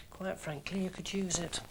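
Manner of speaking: quiet cutting remark; snide aside. Acted. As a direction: drop it under your breath.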